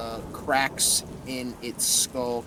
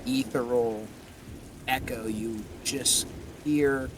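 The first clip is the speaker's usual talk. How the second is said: usual speaking voice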